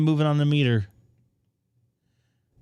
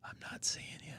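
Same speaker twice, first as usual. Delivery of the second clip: whisper; 4 semitones higher, 20 dB quieter than usual. Real, not acted. Whispered while testing connection.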